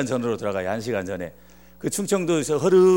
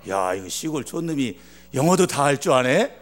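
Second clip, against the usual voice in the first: ordinary talk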